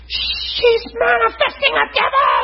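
very high, slow, drawn-out mock alarm; mock panicked shriek. Preacher imitating panicked lady on the phone, mock shriek. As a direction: imitate a frantic caller stretching every word.

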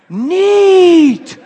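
put-on awed kid, laughing through it; like a little child. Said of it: "said it like four or five times".

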